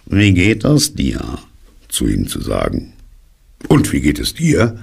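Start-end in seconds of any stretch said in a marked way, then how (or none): 0.1-1.4 slow, drawn-out dismal donkey; higher-pitched, mournfully slow greeting; gloomy greeting. A narrator's reading. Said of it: "in düsterer Weise". 3.7-4.8 quicker, higher return greeting; friendly Pooh. Acted. Pooh returns the donkey's greeting brightly.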